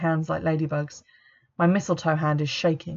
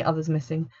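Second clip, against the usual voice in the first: plain reading voice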